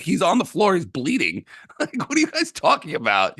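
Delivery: high, laughing disbelief; incredulous retort. Retold or imitated. Fan retells arguing with Sixers fans, high and incredulous. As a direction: throw it back in disbelief, half laughing. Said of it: "I'm like".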